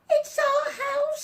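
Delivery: very high squeaky crying voice; mother mouse squeaking in distress. A narrator's reading. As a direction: squeak it like a tiny upset mouse. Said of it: "squeaked the mother mouse".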